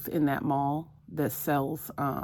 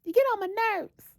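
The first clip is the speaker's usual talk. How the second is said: very high-pitched squeal at a pet; playful mock scolding. Owner fusses at her cute puppy, high mock scolding. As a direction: squeal it, pretending to be fed up.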